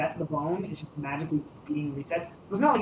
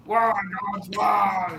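loud, despairing cry to the heavens; anguished monster wail; 4 semitones higher, 6 dB louder, much slower than usual. Acted. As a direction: howl "why" like a wounded beast.

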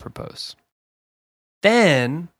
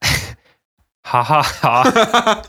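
loud, higher, put-on ha-ha-ha; mock laugh; 5 semitones higher, 5 dB louder, much slower than usual. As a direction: fake the girlfriend's laughing reply. Acts out girlfriend's laughing response.

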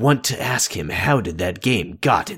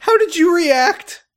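very high loud squeak; squeaky voice; 5 dB louder than usual. Pretend. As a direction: ask it in a tiny high voice.

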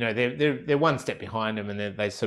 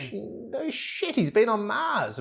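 much higher, slow amazement; incredulous; 12 semitones higher, slower than usual. Real, not acted. Reacting to the Mars man seeing the ocean, much higher. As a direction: marvel at it, pitched way up.

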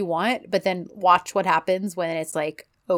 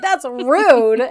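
a touch of mock offense, higher and louder; mock offended; 5 semitones higher, 8 dB louder than usual. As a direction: play at being insulted. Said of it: "she's like".

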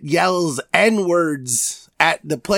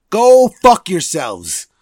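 drawn-out, louder go-away curse; slow mock curse; 2 semitones higher, 7 dB louder, slower than usual. Podcaster cursing out draft picks, slow and loud. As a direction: spit it out slowly, loud.

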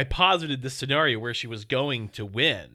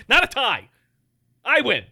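high, loud victory crow; triumphant gloat; 9 semitones higher, 7 dB louder than usual. Real, not acted. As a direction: rub the win in his face. Gloating over won predictions.

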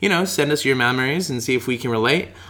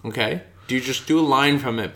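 ordinary delivery

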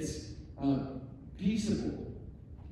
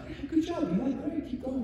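mock encouraging friends, much higher; lightly voiced, half-hearted praise; polite friends; 11 semitones higher than usual. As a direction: humor the would-be singer. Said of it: "they're like".

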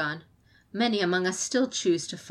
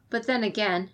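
ordinary delivery

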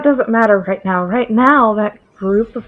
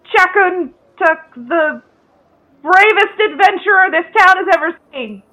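grand herald announcement; 8 semitones higher, slower than usual. Acted.

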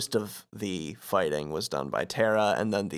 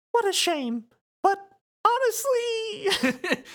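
falsetto mouse, drawing out the last word; Mickey; slower than usual. Acted. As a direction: squeak sympathy in a very high mouse voice. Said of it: "Mickey says".

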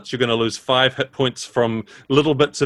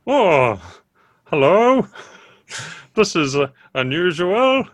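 surprised mushroom voice; 4 semitones higher than usual. Acted. Mushroom answering telepathically.

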